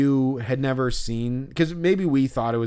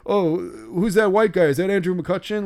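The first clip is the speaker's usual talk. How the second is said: higher, louder, hammed-up dopey fan voice; dumb idiot; 6 semitones higher, 6 dB louder than usual. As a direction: play a clueless fan shouting guesses. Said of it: "if I was some dumb idiot who was like".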